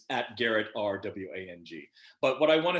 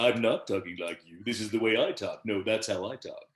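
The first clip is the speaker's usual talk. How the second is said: George Takei as Sulu; 2 semitones lower than usual. Acted. Actor insists to Sulu that it's his own voice, impersonated. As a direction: insist in a put-on admiral's voice. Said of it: "but me doing Sulu's voice".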